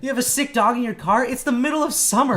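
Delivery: loud, high incredulous rant; comic retold yelling; mock outrage. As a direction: tear into her in disbelief, half laughing. Acted quarrel with customer.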